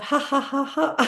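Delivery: big open ha ha laugh; hearty demo laughter. Shown as a demonstration. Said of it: "And laugh it out".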